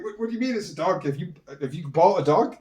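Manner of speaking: mock-shocked friend on the phone. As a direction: fire off the questions in disbelief. Friend shocked by dog news.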